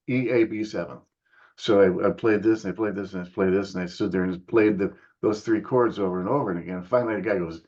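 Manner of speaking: ordinary talk, nothing marked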